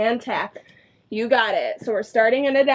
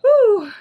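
exhausted whew; 8 semitones higher, 6 dB louder than usual. Real, not acted. Exhale during core work.

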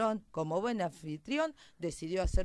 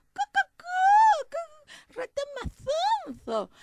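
very high squawky hen voice; scoffing clucking hen; 13 dB louder, slower than usual. Acted. Hen calls the mouse silly, scornful clucking. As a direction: cluck loudly, then dismiss it in a piercing voice.

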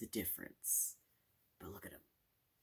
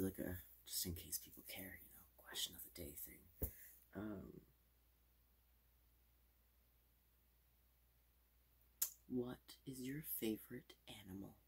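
whispering. Acted. Whispered ASMR question.

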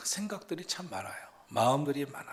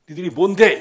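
high, loud burst of mock outrage; acted challenge; 5 semitones higher, 14 dB louder than usual. Retold confrontation before the midwives answer, as an acted challenge. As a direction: throw it out as a sharp challenge. Preacher voices a defiant challenge.

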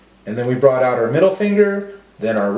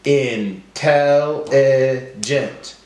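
in... tel... li... gent, very slow; broken into syllables; much slower than usual. Shown as a demonstration. Teacher helping class count syllables, slowly one by one. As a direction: stretch out every syllable separately. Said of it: "break up our words into syllables".

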